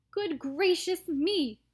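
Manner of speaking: slow dismayed exclamation; startled. Acted. Mr Salt reacts.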